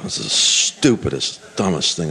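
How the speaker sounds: low acted grumble; bad-mood grumbling. Naaman climbing into the river, grumbling in a bad mood. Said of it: "he's in a bad mood".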